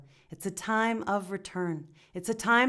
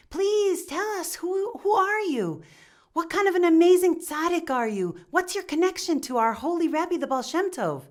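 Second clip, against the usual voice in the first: pleading townsfolk; 7 semitones higher, 4 dB louder than usual. Acted. Townsfolk beg the stranger for the Rebbe's words, awed and pleading. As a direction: plead with him like he's a holy man.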